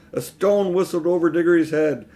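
ordinary delivery

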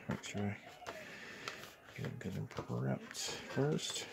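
hushed, half-whispered; quiet at night. Real, not acted. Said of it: "I have to be kinda quiet 'cause it's night".

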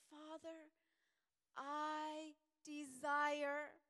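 a drawn-out, loud cry of desire; Jesus crying out to the Father, pleading. Acted. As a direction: stretch the word desire out slowly. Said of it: "He said".

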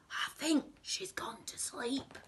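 high, hushed villain voice; Hugh the crook. A narrator's reading. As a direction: whisper it like a sneaking thief. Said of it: "Said Hugh".

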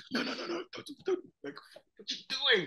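a high, soft squeak of alarm; high panicked pleading. Retold or imitated. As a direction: beg him to stop in a thin high voice. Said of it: "I was like".

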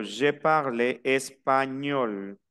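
slow, drawn-out español with a ñ. Shown as a demonstration. Teacher demonstrates the rule, very slowly, español with a ñ sound. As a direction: stretch español, sounding neither G nor N separately. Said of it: "ni tiene por qué escucharse el sonido de la N".

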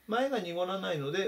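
usual speaking voice

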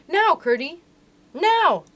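high, loud signal to go; urgent call. A narrator's reading. Girl gives signal to push.

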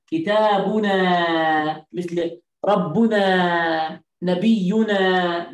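كتابنا ربنا نبينا drawn out, each ending نا stretched; slow, spelled-out teaching cadence; very slow drilling. Shown as a demonstration. Teacher drilling the class, very slow ending نا. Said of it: "لا نقول كتاب نحن".

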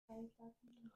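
hushed menacing whisper. Acted. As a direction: whisper it like a threat. Captor whispers the question.

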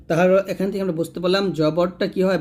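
ordinary talk

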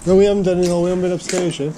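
ordinary talk, nothing marked

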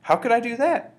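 high, loud, exclaimed question; loud high-pitched outcry; crying out. A narrator's reading. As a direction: cry it out in high-pitched disbelief. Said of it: "I cried".

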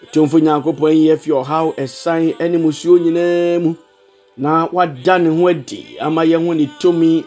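ordinary talk, nothing marked

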